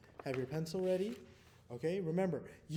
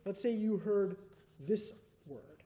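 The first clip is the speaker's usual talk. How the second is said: ordinary delivery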